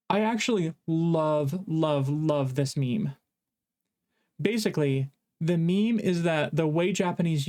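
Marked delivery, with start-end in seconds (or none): 0.1-3.2 drawn-out love. Real, not acted. Gushing about a favourite meme.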